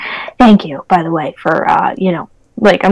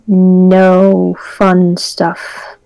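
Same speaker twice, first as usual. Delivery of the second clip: slow; 2 semitones lower, much slower than usual. Acted. Drawn-out in-character hint.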